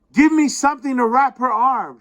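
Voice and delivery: high, loud emergency command; urgent order. A narrator's reading. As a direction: call out for help urgently. Voiced urgent order after injury.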